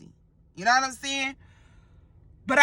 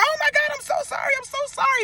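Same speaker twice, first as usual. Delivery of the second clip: frantic apology; 13 semitones higher, faster than usual. Retold or imitated. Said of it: "I grabbed my hand and I was like".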